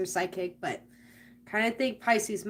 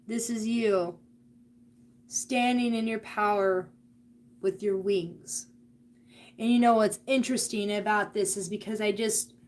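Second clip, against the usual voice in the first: usual speaking voice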